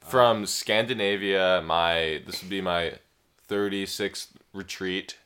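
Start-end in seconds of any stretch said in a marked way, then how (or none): none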